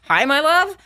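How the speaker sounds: high, rising retort in imitation; acted-out unhinged hello; mock sweet greeting. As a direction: throw the greeting back high, dripping with sarcasm. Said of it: "talk about a movie scene".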